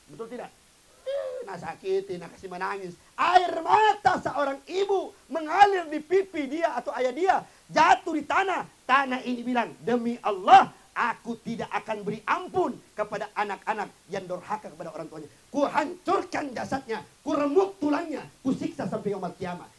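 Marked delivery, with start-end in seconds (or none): 3.2-9.7 loud, high, slow preaching; fiery preaching. Acted. Preacher warning the congregation, loud and slow. Preacher builds to full force. 9.8-19.7 loud, booming curse; thunderous oath. Acted. The earth vowing to crush disobedient children, loud oath.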